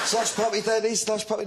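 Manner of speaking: put-on high little kid pleading; toddler voice. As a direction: imitate a two-year-old begging. Toddler son asking dad.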